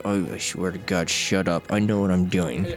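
low, fed-up grumble; weary frustration. Retold or imitated. Veteran player fed up with hints, low frustrated grumble. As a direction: drop low and insist through your exasperation. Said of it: "you just get frustrated".

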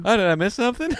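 high, loud breezy hello; mock casual greeting. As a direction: pop back in like nothing happened. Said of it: "I'll be like".

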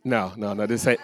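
ordinary talk, nothing marked

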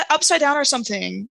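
normal talking voice

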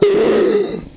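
sharp high-pitched shocked gasp; acted gasp. Reacts to app in story.

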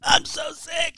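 high whiny sick-day voice; fake sick voice. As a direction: pretend to be sick on the phone. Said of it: "Call, call in and give the old".

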